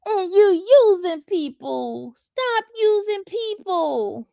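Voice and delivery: hammed-up mimic of a chat commenter; mocking imitation of the commenter. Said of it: "And he says".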